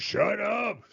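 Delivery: high, sharp mock scream. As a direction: cut the voice off. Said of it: "screamed Toad".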